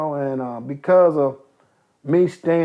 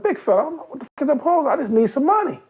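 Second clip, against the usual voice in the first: high, fast exasperated protest; man admitting he doesn't know the word; 11 semitones higher, faster than usual. Retold or imitated. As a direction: blurt it out, fed up. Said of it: "I said".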